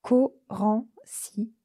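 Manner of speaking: Corancy dragged out, syllables stumbling, very slow; stuttered name. Acted. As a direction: force out Corancy slowly, stuck on the first syllable. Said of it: "Trois syllabes qui se bousculent". (Corancy bégayé, très lent, syllabes qui se bousculent)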